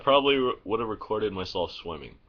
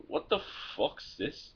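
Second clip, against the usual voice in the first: baffled; 7 semitones higher, 6 dB quieter, slower than usual. Retold or imitated. Confused reaction to song.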